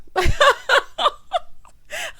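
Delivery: loud, high burst of laughter; real cackling laugh at your own joke; big laugh. Real, not acted.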